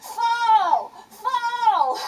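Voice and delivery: frantic high yell of a panicking dad; screaming dad. Retold or imitated. Dad screaming at his son flying down the mountain, frantic high yell. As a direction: yell the warning at full volume. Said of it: "So my dad's screaming".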